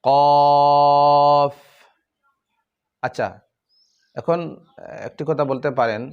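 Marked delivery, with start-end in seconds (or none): 0.0-1.5 কফ drawn out long and louder; three-alif stretch. Shown as a demonstration. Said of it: "তিন আলিফ".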